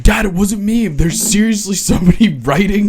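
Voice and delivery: high, loud, laughing mock daughter voice; pleading daughter. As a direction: whine it up high like a desperate kid. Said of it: "And being like".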